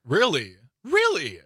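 double-take, escalating in disbelief. Real, not acted. Host to young cohost who knows few bands, incredulous.

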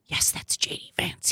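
hushed, whispered confirmation; soft, low whisper; whispered aside. Real, not acted. Whispered aside answering the clip.

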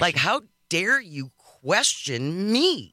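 drawn-out mock outrage, slow and haughty; mock indignation. Host mimics Miller baffled at being questioned, indignant. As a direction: stretch each word in affronted disbelief.

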